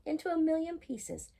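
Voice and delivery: usual speaking voice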